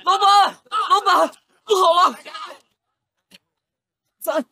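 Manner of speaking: high, loud panicked calling; alarmed calling. Acted. Servant bursts in crying alarm to the boss. Runs in with bad news.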